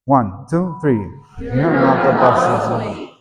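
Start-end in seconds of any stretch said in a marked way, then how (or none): 1.4-3.1 slow, sassy talk-back with a laugh; mock sassy child, giggling. Shown as a demonstration. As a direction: sass it while laughing. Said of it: "So go again".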